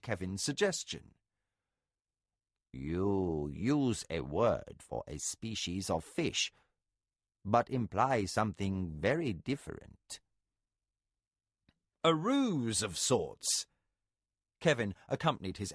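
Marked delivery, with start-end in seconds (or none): 2.7-13.7 a low, slow, measured voice for Arakasi; lightly voiced calm spy, slow and deliberate; understated low character voice. A narrator's reading.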